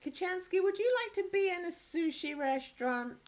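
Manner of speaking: talking to pet. Real, not acted. Addresses her pet.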